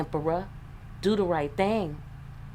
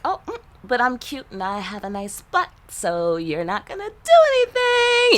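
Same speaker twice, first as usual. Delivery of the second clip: rising into a much higher, louder cutesy voice; mock flirty lady; 7 semitones higher, 5 dB louder than usual. Mocking women who come out their lane, cutesy and much higher. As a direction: play a woman sure her looks get her off.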